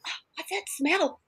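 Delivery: high, breathless whisper of alarm; whispered sniff question. Retold or imitated. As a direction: whisper it like you're sniffing for a leak. Roommates sniffing the air.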